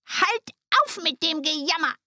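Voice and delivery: high, cross witch voice; scolding old witch. A narrator's reading. Witch cutting short Gretel's wailing, scolding. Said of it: "sagte die Alte".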